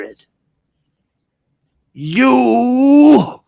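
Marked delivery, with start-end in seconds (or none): 1.9-3.4 "You" stretched long, high and loud; emphatic call-out. Real, not acted. Singles out the addressee.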